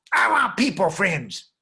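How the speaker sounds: loud, high, drawn-out whine; demanding little girl. Acted. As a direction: wail it slowly like a demanding child. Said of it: "And the way she said".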